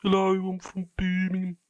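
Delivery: high-pitched put-on Brummie; exaggerated Brummie voice, pitched right up; Birmingham accent. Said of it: "It's fucking Birmingham, innit?".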